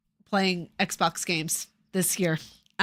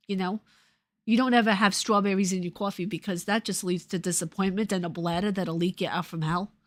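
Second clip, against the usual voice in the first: New Jersey-tinged Brooklyn accent. Shown as a demonstration. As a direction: talk like a Brooklyn local, laid on thick. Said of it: "broken out into the Brooklyn accent".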